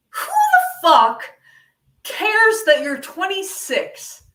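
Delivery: high, loud, slow disbelief; incredulous rant. Acted. Comic ranting about a twenty-six-year-old's birthday party, loudly incredulous. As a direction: stretch every word out in indignant disbelief.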